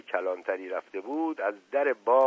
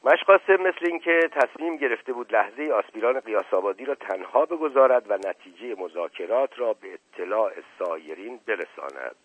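ordinary delivery, nothing marked

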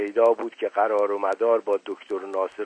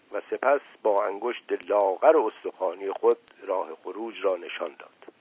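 usual speaking voice

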